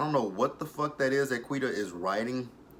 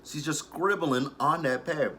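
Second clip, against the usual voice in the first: ordinary talk, nothing marked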